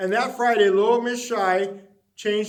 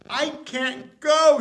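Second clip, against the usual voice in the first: slow anxious refusal; 7 semitones higher, 4 dB louder, slower than usual. Acted. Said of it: "she thought".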